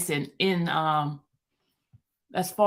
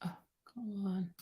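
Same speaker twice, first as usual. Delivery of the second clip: exasperated breathy whisper under the breath; frustrated whisper; 15 dB quieter than usual. Real, not acted. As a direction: whisper it, annoyed at the screen. Struggling with screen share.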